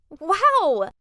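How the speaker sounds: loud awed gasp of a word; amazed wow. A narrator's reading. Narrator marvelling at the country club, loud and amazed. Awed at rich friend's place.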